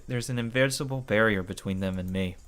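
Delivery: ordinary delivery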